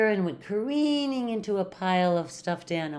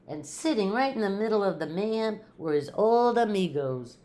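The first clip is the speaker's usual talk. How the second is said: ordinary delivery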